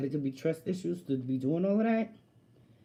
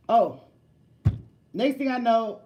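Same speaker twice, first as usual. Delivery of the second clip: ordinary delivery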